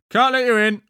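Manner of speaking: high, loud guard voice. A narrator's reading. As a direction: refuse entry loudly, like a sentry. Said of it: "said the first guard".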